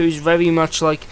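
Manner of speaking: ordinary talk, nothing marked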